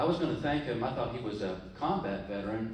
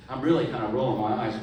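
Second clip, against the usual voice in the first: ordinary talk, nothing marked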